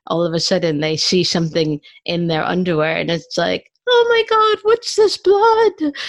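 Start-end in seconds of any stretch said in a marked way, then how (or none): 3.9-5.9 high, loud mock panic, slow on the second half; panicked shriek. A girl finding blood in her underwear, high panicked mock shriek. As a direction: shriek like a girl in sudden shock.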